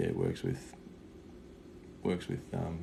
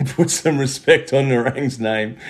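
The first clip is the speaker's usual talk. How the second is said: loud laughing demand; 6 semitones higher, 10 dB louder than usual. Real, not acted.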